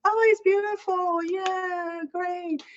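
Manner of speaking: tiny high voice, fading away like chatter behind; imitated background praise. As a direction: mimic polite admirers as ambient noise. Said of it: "ambient noise. Probably there was a lot of other".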